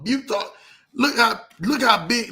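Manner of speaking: ordinary delivery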